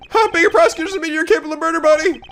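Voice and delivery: fast high taunting voice; mock him fast and high; Larry voice. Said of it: "Shut up, Larry".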